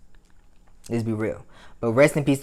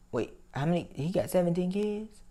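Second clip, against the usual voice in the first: surprised; 6 semitones higher, 7 dB quieter than usual. Retold or imitated. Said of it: "I said".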